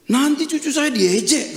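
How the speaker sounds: acted grandparent's objection, high and loud; worried protest. Grandparent warning the pastor about teasing, worried protest.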